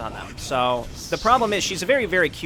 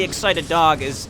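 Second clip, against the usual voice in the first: normal talking voice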